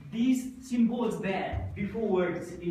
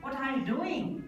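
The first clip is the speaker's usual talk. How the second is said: higher-pitched question, rising on "doing"; rising intonation; 4 semitones higher than usual. Shown as a demonstration. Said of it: "our voice goes up".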